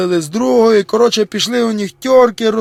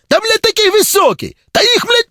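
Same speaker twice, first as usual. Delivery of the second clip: exasperated high voice; 13 semitones higher than usual. Acted. Stalker exasperated, pitch shoots up.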